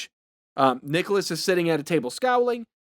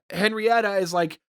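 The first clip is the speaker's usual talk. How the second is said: ordinary delivery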